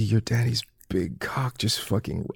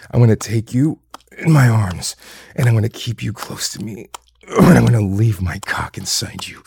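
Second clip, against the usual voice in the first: normal talking voice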